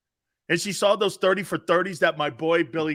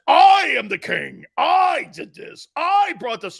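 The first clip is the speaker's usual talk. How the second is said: slow, higher, loud boastful toy voice; mock talking toy; 8 semitones higher, 8 dB louder, slower than usual. The Howie bear toy boasting when clapped, slow hammed-up shout. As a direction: boom it like a bragging talking bear. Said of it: "it says".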